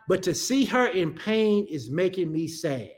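low, subdued admission; understated sorrow, pitched well down; retold sadness. Son telling God about mother's pain, understated sadness.

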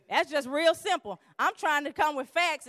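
usual speaking voice

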